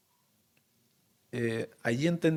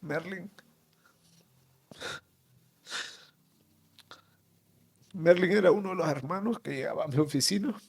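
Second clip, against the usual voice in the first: higher, strained voice after long silences; choked with grief; 4 semitones higher than usual. Real, not acted. Man remembering his late brother Merlin, choked with grief. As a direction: struggle to get the brother's name out.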